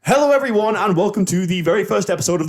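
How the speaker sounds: high-pitched, upbeat host greeting; big welcome that eases down slightly; podcast intro. Acted. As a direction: launch the show like a hyped-up host. Host retakes the show intro.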